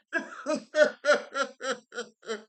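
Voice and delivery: long high staccato laugh; loud giggling laugh in a string of bursts; real high-pitched cackle of amusement. Real, not acted. Laughs after his own remark.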